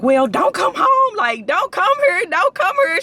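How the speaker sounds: a frantic mother telling her daughter not to come home; panicked mom. Retold or imitated. Mom on the phone telling her daughter to stay away, high frantic voice. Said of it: "My mama like".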